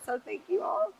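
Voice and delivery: ugly crying. Real, not acted. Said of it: "And I'm done ugly crying".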